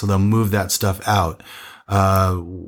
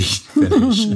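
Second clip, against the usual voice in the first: laughing; 4 dB louder than usual. Real, not acted. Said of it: "Not nasty".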